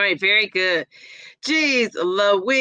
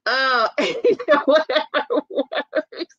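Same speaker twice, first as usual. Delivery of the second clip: laughing; 4 semitones higher, much slower than usual. Real, not acted. Laughing at a comment.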